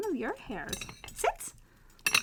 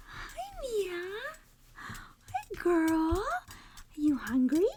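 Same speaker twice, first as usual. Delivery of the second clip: talking to a dog; 6 semitones higher, 4 dB quieter, much slower than usual. Real, not acted. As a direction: coo at a puppy. Greeting her dog Mia.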